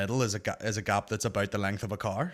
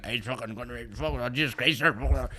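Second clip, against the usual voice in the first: put-on drunk slurring. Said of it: "you can even hear in his voice".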